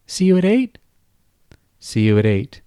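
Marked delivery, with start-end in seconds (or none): 0.1-0.8 much higher girlish voice with a hint of a man underneath; a grown man faking a little girl, pitched way up; babyish high voice. Kyle as Kate signing off with Ron, high and babyish. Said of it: "his Cuddly Kate voice holding".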